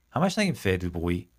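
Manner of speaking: usual speaking voice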